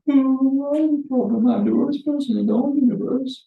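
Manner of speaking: high, whiny mock despair; self-pitying whine. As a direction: play the poor me who sees the world ending. Acting out self-pity over problem.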